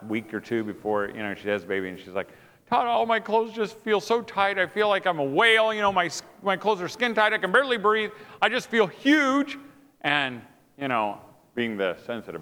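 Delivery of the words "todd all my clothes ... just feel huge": high, complaining imitation of a wife; frustrated wife. Pregnant wife to husband about tight clothes, frustrated. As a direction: moan it, stretching the last complaint. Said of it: "I could tell she was frustrated".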